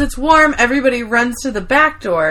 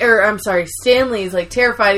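usual speaking voice

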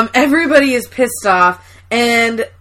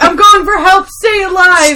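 a touch louder and higher heroic vow; imitating Oscar; 5 semitones higher, 4 dB louder than usual. Oscar leaving through the ceiling, mock heroic. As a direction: promise it bravely from the ceiling. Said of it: "He's like".

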